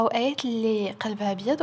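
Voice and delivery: usual speaking voice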